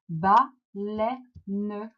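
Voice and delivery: "baleine" in three beats, ba... lei... ne; split into syllables. Shown as a demonstration. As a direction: pause between syllables of "baleine", final e sounded. Teacher splits word into syllables.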